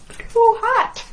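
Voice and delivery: high, playful squeal of a pretend burn; mock too hot. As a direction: yelp it way up high like the heat just got you. Playful reaction for children.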